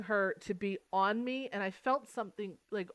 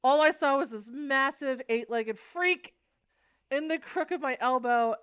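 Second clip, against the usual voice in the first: excited retelling; 8 semitones higher, 8 dB louder than usual. Retold or imitated. Retelling spider fright.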